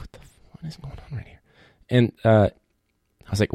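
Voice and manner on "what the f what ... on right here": fast hushed whisper; whispered disbelief. Retold or imitated. Classmates whispering in a silent test room. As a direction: whisper it so the teacher can't hear. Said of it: "everyone's like".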